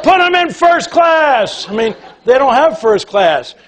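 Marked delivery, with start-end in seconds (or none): 0.0-1.7 high and slow, thrilled; excited order. Retold or imitated. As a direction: call it out in delight. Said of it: "He said".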